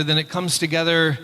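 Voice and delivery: ordinary talk, nothing marked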